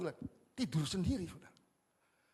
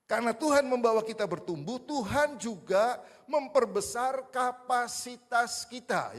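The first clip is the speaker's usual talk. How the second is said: loud, slow and higher; preaching; 5 semitones higher, 9 dB louder than usual. Acted. Pastor preaching to the congregation at full force. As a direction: drive the point home from the pulpit.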